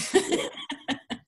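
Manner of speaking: breathy high laugh at himself; short sheepish laugh; amused laugh. Real, not acted. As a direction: laugh it off, a little embarrassed. Laughs at forgetting the word.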